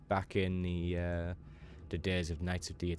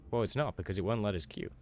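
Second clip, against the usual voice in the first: ordinary talk, nothing marked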